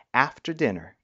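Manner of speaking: usual speaking voice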